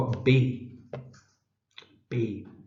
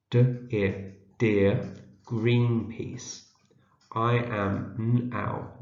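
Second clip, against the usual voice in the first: very slow, word by word with gaps; sounding out; much slower than usual. Shown as a demonstration. Teacher sounds out a letter for early readers, slow decoding. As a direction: break words into sounds, then blend them. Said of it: "see if we can read it".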